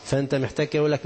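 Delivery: ordinary talk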